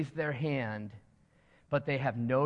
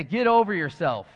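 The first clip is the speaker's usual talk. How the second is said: "Get over yourself" said louder, leaning on "over"; firm, louder call; 2 semitones higher, 8 dB louder than usual. Shown as a demonstration. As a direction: say it out loud for the room, with "over" falling. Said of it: "Look to the person next to you and say".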